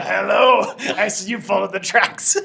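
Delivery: loud, high hail with laughter in it; gleeful mage greeting. Acted. Mage greets the startled tracker, loud and gleeful. Mage surprises player in tavern doorway.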